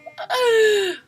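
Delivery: loud, high gasp; startled gasp. Real, not acted. Streamer startled by a donation alert, gasping. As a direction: gasp in sudden shock.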